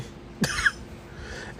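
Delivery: breathy, mostly voiceless chuckle; short soft laugh; amused laugh. Real, not acted. Speaker tickled by how sweetly Sky shades people, amused laugh.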